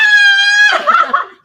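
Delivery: high, loud shriek of laughter; screaming laugh. Retold or imitated. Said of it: "She started screaming and laughing".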